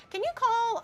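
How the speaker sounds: high-pitched imitation of mother; mock high maternal request; pleading mom. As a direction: wheedle a favour in a high mom voice. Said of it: "And then she was like".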